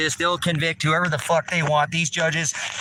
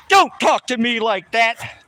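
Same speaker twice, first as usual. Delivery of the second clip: mock prim snap, louder and higher; mocking the lady; 7 semitones higher, 6 dB louder than usual. Angry man mocking the court lady's snub, loud and higher. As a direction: throw her snooty line back in a mocking snap.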